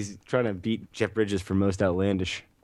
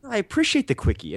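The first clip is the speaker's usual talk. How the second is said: raised, playful pitch; high mock voice; 9 semitones higher than usual. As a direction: say it high and teasing. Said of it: "repeated line as a bit".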